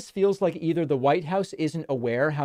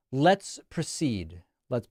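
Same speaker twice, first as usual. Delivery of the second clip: ordinary talk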